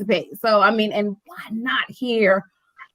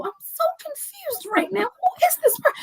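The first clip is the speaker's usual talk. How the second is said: much higher, thin puzzled voice; baffled confusion; 6 dB quieter than usual. Retold or imitated. Owner not recognizing the author, high baffled voice. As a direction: sound totally lost and thrown.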